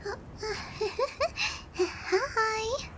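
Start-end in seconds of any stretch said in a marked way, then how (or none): 0.4-1.7 nervous, quieter titter; awkward giggle. Acted. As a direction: laugh awkwardly, caught out. Caught, greets waking captive awkwardly.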